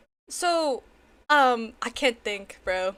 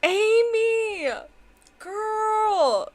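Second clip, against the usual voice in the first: excited; 8 semitones higher, 4 dB louder, much slower than usual. Real, not acted. Calling out to the gifter.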